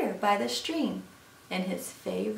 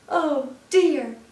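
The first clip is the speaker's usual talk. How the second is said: higher, loud storybook dismay; alarmed fox; 5 semitones higher, 9 dB louder than usual. Acted. Fox takes one look at Gruffalo, alarmed. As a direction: exclaim in acted fright.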